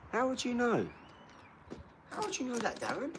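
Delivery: high, wide-eyed fake shock; squeaky mock disbelief; mock astonishment. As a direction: play up the amazement. Mock-incredulous at troll comment.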